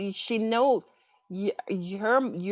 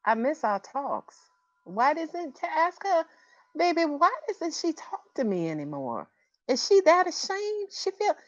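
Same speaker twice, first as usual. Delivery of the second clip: higher, pleading mother; Felicia Rashad voice; 7 semitones higher than usual. Acted. Medium voicing Janet asking after her daughter, pleading higher voice. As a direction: channel a hurt mother, pitched up. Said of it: "she's talking to me in a Felicia Rashad voice".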